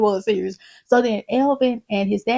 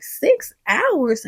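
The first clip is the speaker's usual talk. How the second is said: higher, disbelieving question on "Six hours"; incredulous echo; 6 semitones higher than usual. Retold or imitated. Denise reacts to the airport delay, incredulous. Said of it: "She's like".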